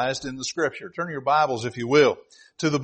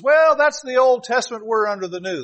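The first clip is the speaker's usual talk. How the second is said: objector; 8 semitones higher, 5 dB louder than usual. Retold or imitated. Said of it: "Someone says".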